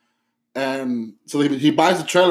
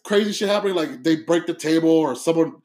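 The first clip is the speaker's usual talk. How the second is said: ordinary talk, nothing marked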